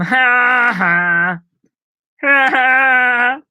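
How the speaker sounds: high cackling Pee-wee Herman laugh. Pretend. A streamer showing off his Pee-wee laugh, high and loud. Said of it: "My Pee-wee laugh is".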